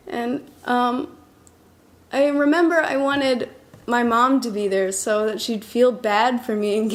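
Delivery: ordinary talk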